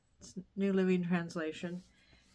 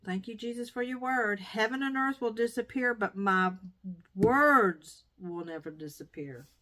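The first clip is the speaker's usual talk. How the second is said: raised, earnest reading of a Bible verse, slowing at the end; scripture reading; 3 semitones higher, 6 dB louder than usual. Acted. To viewers, reading her Bible verse as a fervent declaration. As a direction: proclaim the verse like a promise. Reads scripture aloud with praise.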